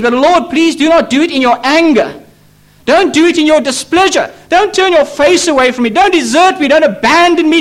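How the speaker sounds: high, insistent plea that builds; urgent pleading. Retold or imitated. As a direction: plead with God as David, phrase upon phrase. Preacher voices David pleading with God.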